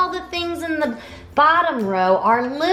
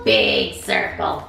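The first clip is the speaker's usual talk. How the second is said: much lower, deep big-ape voice; 6 semitones lower than usual. Shown as a demonstration. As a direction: drop it down low for the big ape. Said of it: "say hi with a big voice".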